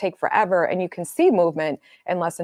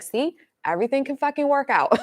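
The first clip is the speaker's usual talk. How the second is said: high, pleased retold self-talk; upbeat vindication; 8 semitones higher than usual. Woman to herself once the keys are sorted, brightly vindicated. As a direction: lift it high, as if proving your point.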